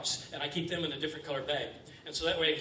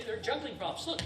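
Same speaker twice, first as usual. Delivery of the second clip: ordinary delivery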